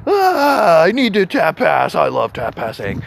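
hammed-up half-sob, slowed down; whiny lament. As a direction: whine it like a fake cry. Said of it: "I was like".

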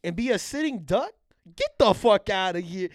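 high, loud incredulous comeback; mock indignation. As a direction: scoff back at a silly suggestion. Acted kid scoffing at his mom.

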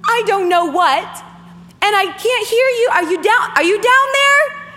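pleading with God from the edge; earnest plea. Real, not acted. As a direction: cry out to be caught. Addressing God in surrender.

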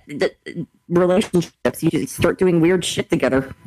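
ordinary delivery

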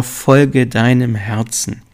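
slow, weighty motto with FOLGE leaned on; drawn-out, deliberate motto. Shown as a demonstration. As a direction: spell out „Folge deinem Herzen.“ slowly, as a creed. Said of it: "ich sag ja immer".

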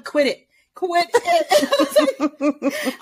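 put-on high, accented dog voice; put-on Spanish accent for a talking dog; [name]. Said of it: "just doing that voice for everything".

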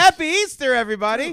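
loud high shout to the room; yelling. Real, not acted. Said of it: "the yelling".

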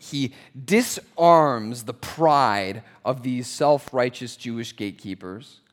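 ordinary delivery

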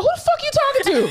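high laughing retort; retold snap at patient. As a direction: fire back at the rude patient, laughing. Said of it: "I was like".

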